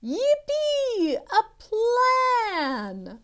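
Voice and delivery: very high loud cheer; excited cheer. Acted. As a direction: celebrate the plan.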